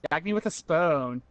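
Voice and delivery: put-on valley girl voice. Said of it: "my Valley Girl impression".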